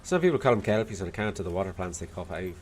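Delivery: put-on Irish accent with a Scottish lilt; attempted Dublin accent. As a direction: do a not-very-good Dubliner. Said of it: "Scottish lilt out of the attempt at an Irish accent".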